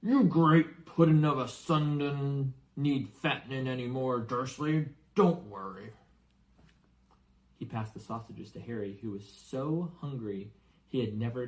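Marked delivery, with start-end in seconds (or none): 0.0-5.9 louder giant mocking with dark humour; dark chuckling giant. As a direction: tease Vernon with a grim edge. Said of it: "chuckled darkly".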